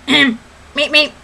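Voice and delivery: quick little cartoon beeps; two short, identical bird honks; Roadrunner. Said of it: "now for the Roadrunner".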